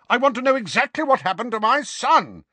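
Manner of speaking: very high, strained roar; furious roar. A narrator's reading. Father demanding what happened to his son, roaring. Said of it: "roared Uncle Vernon".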